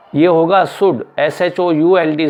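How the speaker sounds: ordinary talk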